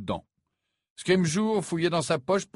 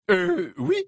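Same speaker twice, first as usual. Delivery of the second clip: hesitant minister; 5 dB louder than usual. Acted. Premier ministre hésitant.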